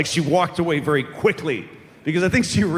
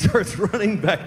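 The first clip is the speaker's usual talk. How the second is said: ordinary delivery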